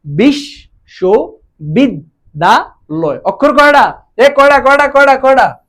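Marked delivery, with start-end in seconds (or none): 0.0-3.2 বিশ্ববিদ্যালয় broken into slow separate syllables. Shown as a demonstration. Teacher counting syllables for admission students, very slow and spaced. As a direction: spell বিশ্ববিদ্যালয় out very slowly, one syllable at a time. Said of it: "এবার তুমি দেখো".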